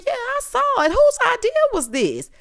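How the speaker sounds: squeaky put-on disapproving mom; imitating Mama Joyce. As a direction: pitch it way up, scandalized. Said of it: "So she said".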